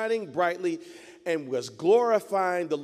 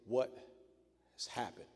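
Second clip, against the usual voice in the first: hushed, much softer and lower, aching; grieving question; 7 semitones lower, 13 dB quieter than usual. Acted. Jeremiah looking over the ruined city, hushed grief. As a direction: let the question barely escape, heartbroken.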